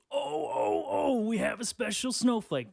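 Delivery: high, loud mocking wail; terrible Filthy Frank. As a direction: do a bad, over-the-top caricature. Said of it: "That's a terrible Filthy Frank impersonation".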